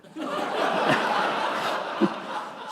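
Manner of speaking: an airy laugh under the breath; soft laugh. Real, not acted. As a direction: let a quiet laugh out. Laughing after the punchline.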